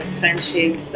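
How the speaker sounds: plain reading voice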